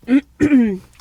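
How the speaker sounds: clearing choking cough. Real, not acted. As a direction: cough it down the wrong pipe. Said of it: "J'ai mal de travers".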